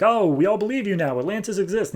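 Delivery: high-pitched mock crowd voice; mock believers. People tell Doctor Shin he was right, mock believers. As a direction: gush it high, imitating converts.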